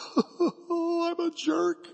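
high, drawn-out comic sobbing; hammed-up tearful confession; mock wailing. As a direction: blubber it in an exaggerated high wail. Tearful realization, acted out.

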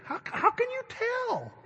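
very high, drawn-out mock disbelief; exaggerated bafflement. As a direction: squeal it slowly like a comically baffled husband. Husband baffled in retold quarrel.

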